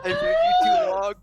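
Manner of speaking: high, loud mock wail at the book; mock anguished cry. Player wailing at the riddle book, loud mock anguish.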